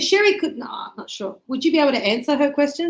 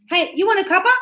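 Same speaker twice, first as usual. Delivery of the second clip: bright, louder, higher "Hey, you want a cuppa?" tossed off to a mate; casual friendly offer; 6 semitones higher, 5 dB louder, much faster than usual. Shown as a demonstration. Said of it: "Very common".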